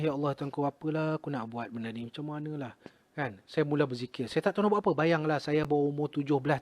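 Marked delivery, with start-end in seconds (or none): 0.0-2.7 soft, helpless lament; retold tearful plea. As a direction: imitate a tearful prayer, fading quieter. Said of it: "Jadi saya pun menangis". (rintihan pilu, lakonan semula tangisan)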